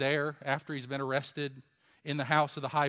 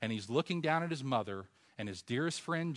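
usual speaking voice

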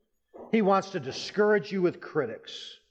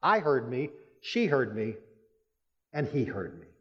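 ordinary delivery

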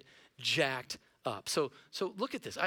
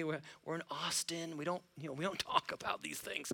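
softer, joking hush about Austin; teasing hushed aside; 7 dB quieter than usual. Acted. Pastor teasing the Austin crowd, hushed and joking. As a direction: murmur it like a shared secret.

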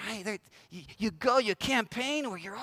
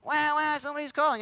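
higher mock baby wail; crying baby phone; 6 semitones higher than usual. Phone notifications as crying babies, mock higher wail. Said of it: "crying babies".

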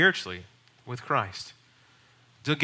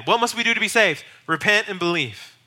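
raised, higher preaching call; loud, higher apostle's call; preaching Peter; 7 semitones higher, 5 dB louder than usual. Retold or imitated. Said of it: "Peter, again and again".

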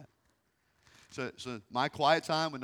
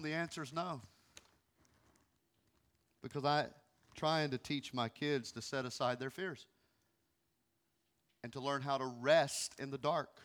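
ordinary delivery

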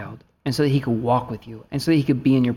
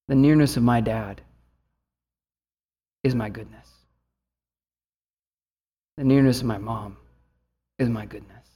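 tender heart voice; 4 semitones lower than usual. Retold or imitated. Voicing son's heart, sincere.

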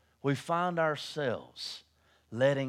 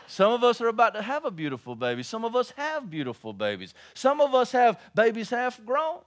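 preaching; 8 semitones higher, 8 dB louder than usual. Acted.

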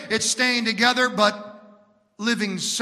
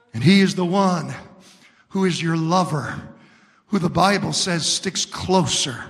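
ordinary talk

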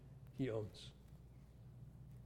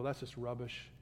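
quiet devotion; 5 semitones lower, 9 dB quieter than usual. Retold or imitated. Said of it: "and go".

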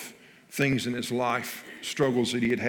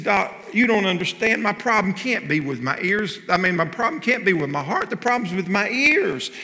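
raised, louder voice of a patient pleading his case; insistent patient; 8 semitones higher, 6 dB louder than usual. Acted. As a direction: push back on the doctor, a bit louder and higher.

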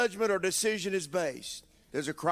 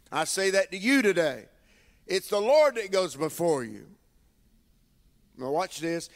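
normal talking voice

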